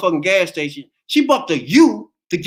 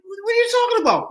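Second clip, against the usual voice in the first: disbelief; 13 semitones higher than usual. Retold or imitated. Retold stop, disbelieving reply.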